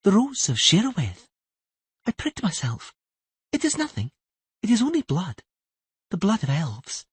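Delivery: put-on high, even girl's voice; a child telling it's nothing; calm young girl. Siri telling Tris her wound is nothing.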